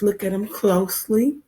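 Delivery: ordinary talk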